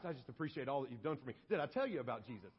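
mock-casual, fast, low-pitched patter; chatty prisoner. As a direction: slip quickly into friendly evangelizing, voice dropped. Acted chatty Paul to his guard.

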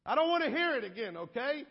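loud, high mock protest; exasperated guard. Acted guard protesting Paul's preaching.

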